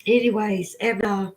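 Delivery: usual speaking voice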